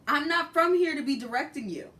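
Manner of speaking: loud, high, fed-up protest; loud exasperated aside; exasperated outburst. Real, not acted. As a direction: blurt it out in disbelief.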